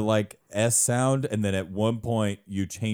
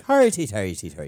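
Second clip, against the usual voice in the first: Irish brogue on hardy, a bit louder; demonstrating the stock Irish ending; Irish dialect; 4 dB louder than usual. Shown as a demonstration. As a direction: belt out the Irish tag line. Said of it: "my end for an Irish dialect".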